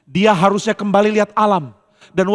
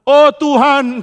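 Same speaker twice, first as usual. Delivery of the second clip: fervent cry; 7 semitones higher, 6 dB louder, much slower than usual. Retold or imitated. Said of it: "kita bisa mengatakan".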